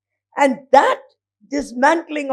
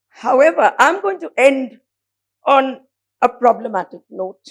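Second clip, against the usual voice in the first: ordinary talk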